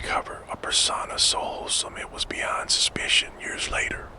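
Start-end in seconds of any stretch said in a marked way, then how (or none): none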